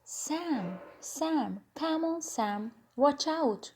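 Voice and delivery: urgent, restrained storyteller's shout; mild acted shout. A narrator's reading. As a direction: call out anxiously without really shouting. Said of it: "Anna shouted".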